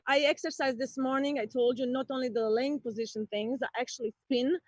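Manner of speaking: ordinary delivery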